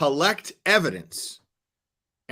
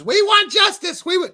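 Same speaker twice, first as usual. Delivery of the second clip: high, loud hammed-up yell; mock screaming protester; 9 dB louder than usual. Woman yelling at the state attorney's office, mock scream. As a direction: screech it like a protester, cut off mid-word. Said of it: "yells and screams".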